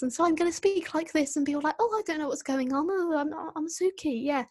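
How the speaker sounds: put-on high, fast, ditzy girly voice; bad Suki impression. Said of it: "that was a really bad impression".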